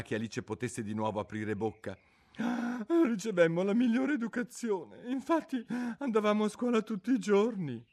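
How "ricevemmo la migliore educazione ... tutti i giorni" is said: high, mournful creature voice; a sorrowful old turtle reminiscing; mock turtle voice. Mock turtle telling Alice about school under the sea, mournfully.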